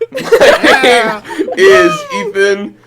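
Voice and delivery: ordinary delivery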